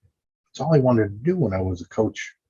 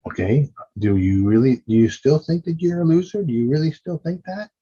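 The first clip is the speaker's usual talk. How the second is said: higher, louder, incredulous questioning; a teasing challenge to the inner critic; challenging yourself; 7 semitones higher, 3 dB louder than usual. Shown as a demonstration. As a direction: ask your inner self in disbelief. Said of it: "work on that and say".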